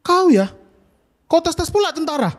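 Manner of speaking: high, loud indignant rebuke; angry soldier. Acted. Soldier telling off a cheeky village kid, angry. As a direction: scold like an offended soldier. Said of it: "Tentaranya marah, marah, saat itu selalu marah".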